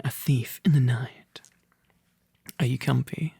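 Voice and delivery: ordinary delivery, nothing marked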